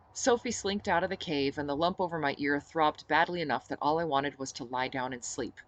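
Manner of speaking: plain reading voice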